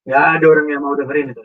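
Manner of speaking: ordinary delivery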